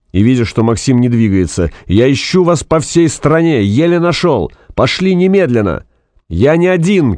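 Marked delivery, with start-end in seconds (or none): 1.9-5.8 high-pitched insistent tirade; exasperated shouting. A narrator's reading. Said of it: "Фанк поперхнулся от крика". 6.3-7.2 high-pitched insistent cry; shouted protest. A narrator's reading. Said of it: "крикнул Максим".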